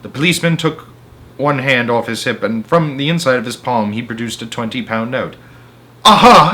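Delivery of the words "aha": high, loud aha; excited cry. A narrator's reading. Henry crying out at the policeman's note. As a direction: cry out in triumph. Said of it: "Henry cried".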